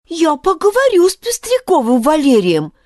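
loud, eager exclamation; a brave boy's outburst; exclaiming. A narrator's reading. Grishka to the sparrow, eager exclamation. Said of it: "воскликнул Гришка".

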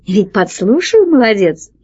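ordinary delivery, nothing marked

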